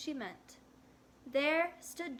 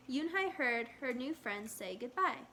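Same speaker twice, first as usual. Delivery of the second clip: ordinary delivery, nothing marked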